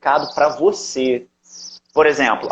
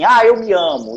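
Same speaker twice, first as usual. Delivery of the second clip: forced affirmation; 7 semitones higher, 6 dB louder than usual. Retold or imitated. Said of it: "dizer assim".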